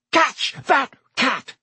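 high, slow, punched-out yell. A narrator's reading. Ron calling out as the cat escapes, high yell. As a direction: yell each word separately, pitched well up. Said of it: "Ron yelled".

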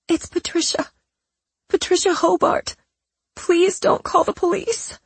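high, trembling, pleading voice; fake scared voice. Patricia fakes fear on the phone.

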